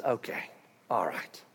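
soft unvoiced resigned whisper; God whispering his okay. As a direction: breathe it out, giving in. God quietly relents.

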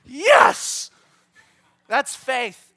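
ordinary delivery

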